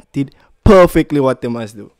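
normal talking voice

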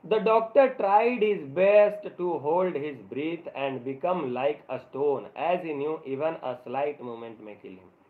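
slow, word-by-word reading aloud; slow story reading. A narrator's reading. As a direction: read each English word out carefully for the class. Reads English story text slowly. (धीरे-धीरे, एक-एक शब्द पढ़ते हुए कहानी)

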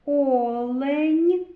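loud, rising Олень with the first О stretched; sounding out the word. Shown as a demonstration. Teacher sounding out Олень during sound analysis. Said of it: "О. Голосний".

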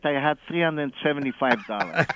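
usual speaking voice

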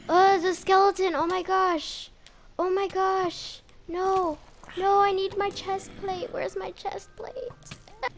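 normal talking voice

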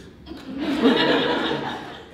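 sudden high burst of laughter. Retold or imitated. Coach's reaction in meeting room.

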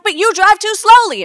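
high, loud frantic protest; frantic yelling. Retold or imitated. Daughter arguing with her friend over driving, yelling. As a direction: yell it back in a panic. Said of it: "I'm yelling at her".

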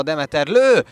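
high, loud goal call; excited hungarian sports commentary. Real, not acted. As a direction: yell the goal with joy. Equalising goal called live.

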